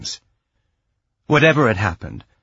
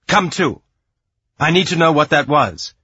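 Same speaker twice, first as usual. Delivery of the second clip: loud, higher, urgent demand; snapped command; 5 semitones higher, 5 dB louder than usual. A narrator's reading. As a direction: snap him out of it, louder and pitched up. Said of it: "she snapped, and it sounded more like her own voice again".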